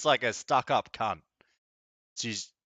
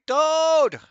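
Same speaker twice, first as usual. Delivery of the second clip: exasperated shout; 11 dB louder than usual. Real, not acted. Protesting at a skipped cutscene.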